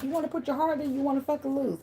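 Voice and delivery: high sarcastic mock voice. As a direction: taunt the scared self. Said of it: "They're like".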